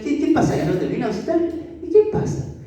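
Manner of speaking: high, teasing Jesus voice; Jesus shrugging it off. Acted. Preacher voicing Jesus teasing the upset believer, high and light. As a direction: play Jesus making light of the complaint.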